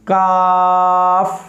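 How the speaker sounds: काफ drawn out very slowly, thin and light; light kaf. Shown as a demonstration. Teacher to students, modelling kaf, light and slow. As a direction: hold काफ long without a y-glide. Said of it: "इसको सीधा पढ़ना है".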